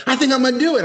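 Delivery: high-pitched quoted voice; Ace. Retold or imitated. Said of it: "he goes".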